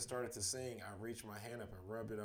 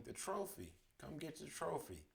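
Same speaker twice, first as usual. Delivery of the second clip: lifted, higher-pitched call at normal volume; 6 semitones higher than usual. A narrator's reading. Bandmates to fleeing friend about the trophy, calling out. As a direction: mildly call someone back. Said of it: "I heard Christina and Jared calling".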